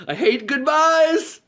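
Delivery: high, drawn-out wail-like yell; mock melodramatic farewell. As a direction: ham up a clingy goodbye, slow and loud. Melodramatic clingy airport farewell.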